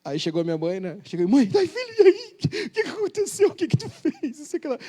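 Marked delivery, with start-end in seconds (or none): 1.3-4.8 high, laughing fretful mother voice; mother frets at her son, high and laughing; worried mother. Retold or imitated. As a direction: play the anxious mom fussing over her son.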